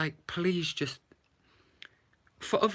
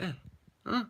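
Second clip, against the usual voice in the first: underwhelmed; 7 semitones higher than usual. Retold or imitated. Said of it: "The whole movie just left me like".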